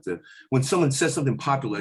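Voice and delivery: ordinary talk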